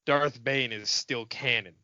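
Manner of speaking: normal talking voice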